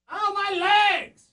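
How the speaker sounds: high fake pained wail, slow; shouting while wounded. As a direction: wail like a crash victim faking injury.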